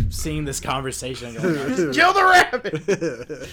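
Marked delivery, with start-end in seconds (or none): 1.7-3.5 slow, high, loud laughing command; laughing yell. Acted. Sleep demon shouting the order, laughing slowly. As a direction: drag it out like a mocking demon.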